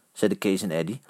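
ordinary delivery, nothing marked

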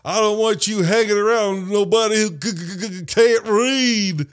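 hammed-up Southern dad scolding his son; imitating the movie dad. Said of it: "where he's like".

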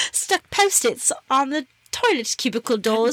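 put-on British accent. Host playfully rereading a listener's email, mock British accent. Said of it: "if I do my British accent".

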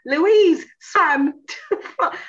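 very slow, high, loud, laughing; excited announcement. Retold or imitated. Announcing to the sewing class, slow and laughing high. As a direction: draw it out in giddy amazement.

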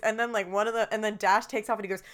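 ordinary talk

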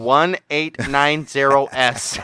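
slow, deliberate digit-by-digit recital; mock spelling-out. Co-host told to spell the decade, slow pedantic delivery. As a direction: spell the year pedantically, very slowly. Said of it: "spell eighteen nineties".

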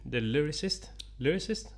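"Lyricist" tried tentatively, rising at the end; trying out pronunciation. Shown as a demonstration. Unsure host asking co-host how to say it, questioning "Lyricist". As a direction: test "Lyricist" softly as a question. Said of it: "Hur skulle du uttala det?".

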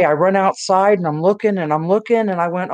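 ordinary talk, nothing marked

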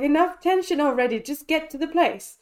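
mock-exasperated plea to hurry it along; exasperated retold reaction. Viewer urging the film's drivers to get there, exasperated.

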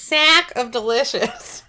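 slow, high upstate New York accent; drawn-out upstate New York accent. Shown as a demonstration. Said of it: "Say it again".

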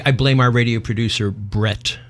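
normal talking voice

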